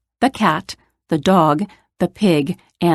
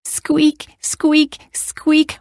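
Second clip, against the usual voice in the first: high little squeaks; squeaky bed; 7 semitones higher, much slower than usual. A narrator's reading. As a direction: imitate a creaky bed. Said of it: "went the bed even louder".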